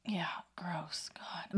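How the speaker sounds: breathy whisper of disgust. Real, not acted. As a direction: whisper it, grossed out. Disgusted at cockroaches.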